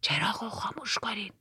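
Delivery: whispered order from the boatman; hushed, secretive instruction; low voice. A narrator's reading. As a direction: whisper it, barely any voice. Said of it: "با صدای آهسته گفت".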